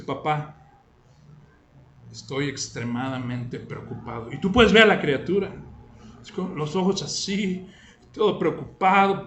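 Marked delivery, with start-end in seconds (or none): 0.0-4.3 put-on small, low, hushed child's voice, much softer; a fretful little boy confessing his worry; worried child. Son anxiously telling his father he is worried, hushed small voice. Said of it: "viene tu hijo. Dice".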